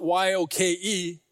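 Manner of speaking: letters "Y O K E" spelled one by one, slow; spelled out slowly. Shown as a demonstration. Preacher to congregation spelling the word, slow letters. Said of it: "I always thought of yoke".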